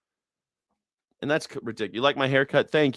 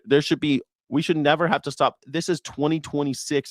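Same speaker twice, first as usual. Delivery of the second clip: normal talking voice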